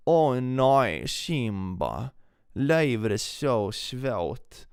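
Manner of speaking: put-on moaning high in a southern Swedish accent; slow, drawn-out southern Swedish lament. Mourning the cat's hard life in southern Swedish accent. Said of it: "That's like a southern Swedish accent".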